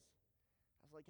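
whispered aside; unvoiced whisper. Real, not acted. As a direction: breathe it.

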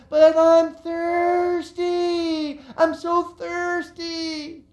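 put-on high, drawn-out childish whine; whiny kids. Kids on trikes whining to mom, high and slow. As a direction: whine like a thirsty little kid. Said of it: "and, like".

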